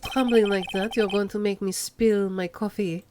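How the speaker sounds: put-on smooth French accent crooning at the rookie; a hint of a lazy French accent. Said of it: "made Armando have a French accent".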